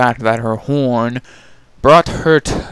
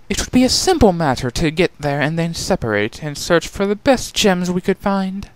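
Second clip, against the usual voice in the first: lightly higher, assured princess voice; confident princess; 7 semitones higher than usual. Acted. Chrysalis explaining the plan to her sisters, lightly confident. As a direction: lay out the plan.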